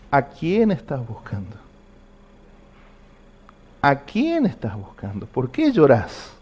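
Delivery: slow, gently drawn-out questioning; lightly voiced Jesus, taking time over each question. Jesus asks the weeping woman who she seeks, slow gentle questioning. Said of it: "es él".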